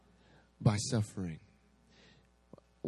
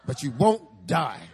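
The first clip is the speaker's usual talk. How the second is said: slow, solemn prophet voice with a laugh; drawn-out reassuring pronouncement; slow prophetic promise; 3 semitones higher, 7 dB louder, slower than usual. Retold or imitated. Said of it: "He's like".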